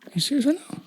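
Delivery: disbelieving question pitched high; incredulous. Retold or imitated. Dad answering toddler's nine o'clock pancake request, incredulous. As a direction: sound like you cannot believe it. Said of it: "I go".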